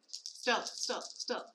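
whispered chant to the dice; hushed plea for luck; whispering. Real, not acted. Muttering while rolling dice.

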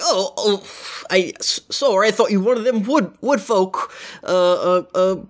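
high, hurried apologetic guard; suddenly meek guard calling to his mate; flustered guard. Acted. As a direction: backpedal fast like a caught-out guard.

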